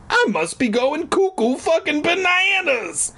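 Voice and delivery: very high, stretched comic outburst; hammed-up high-pitched losing it; mock hysteria. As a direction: squeal it as if cracking up. Narrator declares he is losing it.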